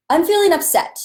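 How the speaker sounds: high, loud upset outburst. Shown as a demonstration. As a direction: blurt out being upset. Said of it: "you say how you're feeling in this moment".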